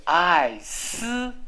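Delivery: 爱丝 drawn out, ending in a hissed "sss". Shown as a demonstration. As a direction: say 爱丝 slowly, with a clear voiceless s at the end. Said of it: "这个是念丝". (爱丝，尾音念清楚的"丝")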